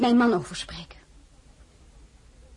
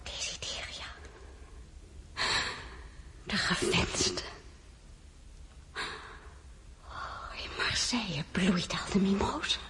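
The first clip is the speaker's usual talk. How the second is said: zacht, haast toonloos fluisteren; gefluisterd in zichzelf; 2 semitones lower, 9 dB quieter, slower than usual. Acted. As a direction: fluister het verlaten voor je uit. Alleen, verdrietig na de audiëntie. (alleen achtergebleven, zacht fluisterend van verdriet)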